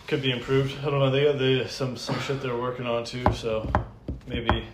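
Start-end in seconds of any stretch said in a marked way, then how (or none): none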